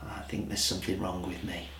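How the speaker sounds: a quiet sheepish aside; self-mocking aside. Real, not acted. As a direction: confess it under your breath. Self-mocking aside about C5s.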